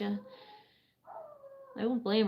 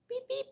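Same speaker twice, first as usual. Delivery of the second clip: squeaky high "beep, beep" for the pet; high beeping coo; 4 dB quieter than usual. Real, not acted. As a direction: chirp it high like a toy. Playful noise to bird.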